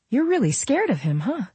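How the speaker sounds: high-pitched probing question; Camille. A narrator's reading. Camille probing Nola about her fear, high and inquisitive. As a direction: lift the voice high and probe.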